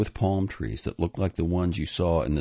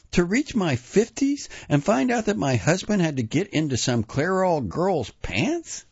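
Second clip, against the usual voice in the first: very high, impassioned outcry; inner scream. A narrator's reading. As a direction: cry it out at a high pitch, as if wanting to scream. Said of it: "her wanting to scream".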